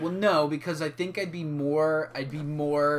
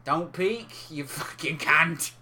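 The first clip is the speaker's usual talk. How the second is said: broad Aussie swearing, last word drawn out; mock Australian accent; slower than usual. Podcaster scolding co-host for peaking, mock Australian accent. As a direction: swear slowly in put-on Australian. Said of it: "say it in an Australian accent".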